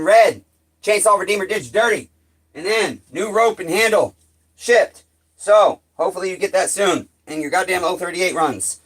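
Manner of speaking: high and loud, calling across the yard; calling out to neighbor. Real, not acted. Calling over to neighbor Jimbus about his shipped saw, loud and high. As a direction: project up to the neighbor far off.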